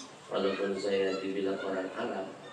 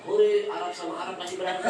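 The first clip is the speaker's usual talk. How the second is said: mock cheer; 9 semitones higher, 6 dB louder than usual. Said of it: "Jamaah saya dipakai tepuk tangan".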